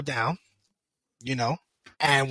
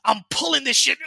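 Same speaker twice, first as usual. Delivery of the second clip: ordinary talk, nothing marked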